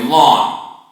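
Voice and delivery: firmly corrected lawn; emphatic lawn. Shown as a demonstration. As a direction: plant the word lawn like a correction. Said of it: "not yard".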